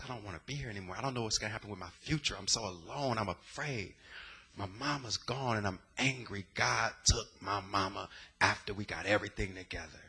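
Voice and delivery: hushed confession. Retold or imitated. Telling his therapist he's depressed, hushed and low. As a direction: confess it quietly, barely holding together.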